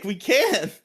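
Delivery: high, loud, pleading objection; protesting Rangers. Retold or imitated. Rangers protesting to Zordon about killing the pig, high. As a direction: whine that it's just a pig. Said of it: "they're like".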